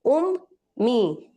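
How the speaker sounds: उम मी in two slow beats, m held nasal; split syllables. Shown as a demonstration. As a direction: break it apart slowly. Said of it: "किस तरह से देंगे".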